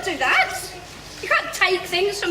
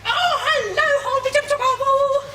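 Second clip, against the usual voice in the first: cheery greeting; 8 semitones higher than usual. Retold or imitated.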